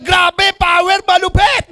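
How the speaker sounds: high-pitched hype shout; overexcited. Acted. Recruit with no pay-in still answering upline, overexcited. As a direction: fire out the slogans like a pumped-up recruit. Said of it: "Sa sobrang gigil eh".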